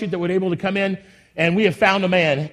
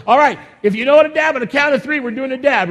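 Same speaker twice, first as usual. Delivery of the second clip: rallying; 7 semitones higher than usual. Real, not acted. Rallying congregation for dab.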